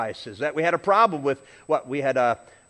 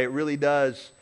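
ordinary talk, nothing marked

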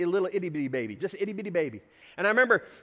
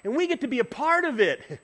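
ordinary delivery

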